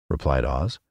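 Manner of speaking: ordinary delivery, nothing marked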